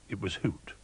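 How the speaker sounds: ordinary delivery, nothing marked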